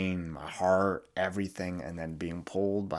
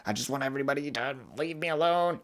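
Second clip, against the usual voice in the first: high, put-upon whining groan; mock overwhelmed whine, much higher; exasperated whine; 8 semitones higher than usual. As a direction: whine like everyone is too much. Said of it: "And I'm like".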